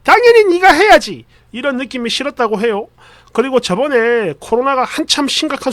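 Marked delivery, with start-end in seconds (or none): 0.0-1.2 high, loud mock scolding; fussing mother-in-law. Mother ordering the wife to do dishes, high mock scolding. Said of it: "완전 난리가 나면서".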